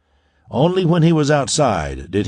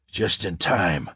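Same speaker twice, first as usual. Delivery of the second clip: breathless, softer gasp of relief; 4 semitones lower, 5 dB quieter, slower than usual. A narrator's reading. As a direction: let it out on a spent breath. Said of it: "Kurt gasped".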